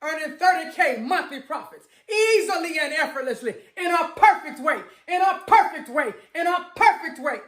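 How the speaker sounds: rising to an ecstatic, tearful chant of thanks; fervent affirmation. Shown as a demonstration. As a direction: pour everything into it like it already happened. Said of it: "I'm gonna say this one more time for you".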